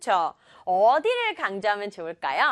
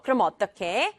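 ordinary delivery

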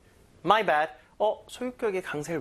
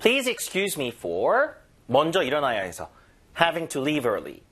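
slow model read; much slower than usual. Shown as a demonstration. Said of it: "문장으로 갑니다".

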